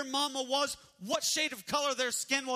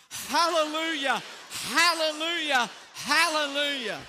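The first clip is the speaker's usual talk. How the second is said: loud, raised praise; full volume; 5 semitones higher, 6 dB louder than usual. Shown as a demonstration. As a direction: lift it up, loud and bright. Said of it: "with volume".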